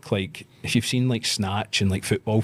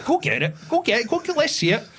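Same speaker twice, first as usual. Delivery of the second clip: daring taunt; 3 dB louder than usual. Retold or imitated. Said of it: "I went".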